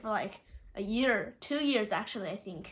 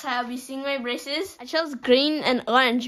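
lip pulled down by finger; talking while showing teeth; 2 semitones higher, 6 dB louder than usual. Real, not acted. Seen 0: fingertip pulls lower lip down to show braces while talking.

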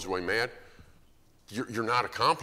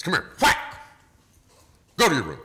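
put-on loud, higher, barking father snapping at his kid; harsh dad; 9 semitones higher, 11 dB louder, faster than usual. Dad yells at kid walking by, loud and high. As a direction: bark it out like a fed-up dad with no patience. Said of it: "and Dad says".

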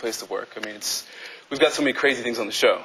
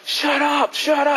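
high yelled complaint, higher pitch; annoyed neighbor; 13 semitones higher, much slower than usual. Retold or imitated. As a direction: shout it like a fed-up neighbor. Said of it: "neighbors unhappy".

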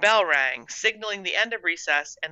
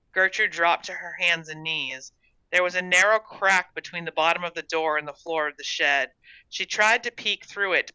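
ordinary delivery, nothing marked

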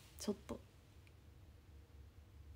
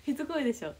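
mock scolding; 5 semitones higher, 5 dB louder than usual. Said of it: "って".

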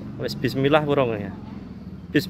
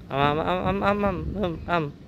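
nom nom; 7 semitones higher than usual. Pretend. Feeding the child, cooing.

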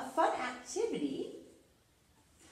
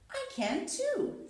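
high, squeaky copycat reply; lightly voiced Cleo; 13 semitones higher than usual. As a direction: chirp it back like a cheeky copycat.

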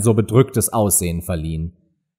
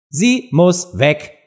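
langsam und bestimmt; entschiedener König; 5 semitones higher, much slower than usual. A narrator's reading. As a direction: setz es entschieden, Wort für Wort. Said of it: "sagte der König sehr entschieden". (der König, sehr entschieden)

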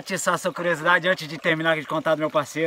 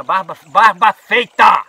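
loud, slow, stretched amazement; telling viewers the partner arrived clean-shaven, amazed; 3 semitones higher, 12 dB louder, much slower than usual. Real, not acted. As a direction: drag out the punchline in disbelief.

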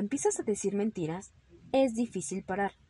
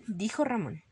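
usual speaking voice

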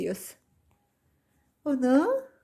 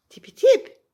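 high squeal; 12 dB louder than usual. Acted. Playful sound to the cat.

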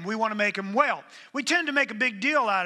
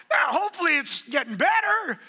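much higher and louder through laughter; laughing shout; 11 semitones higher, 5 dB louder than usual. Real, not acted. Preacher teasing the congregation about marriage, laughing shout. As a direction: call it out over the laughter.

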